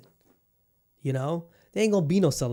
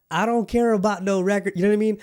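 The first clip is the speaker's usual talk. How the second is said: loud, higher, worked-up outburst; heated rant; 6 semitones higher, 6 dB louder than usual. Real, not acted. Imagining himself in LeBron's place after a loss, heated loud rant. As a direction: blow up like a fan furious about the loss.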